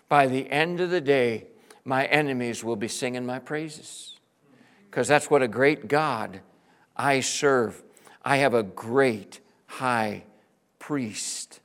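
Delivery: usual speaking voice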